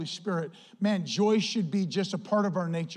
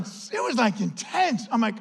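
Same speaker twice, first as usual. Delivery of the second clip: normal talking voice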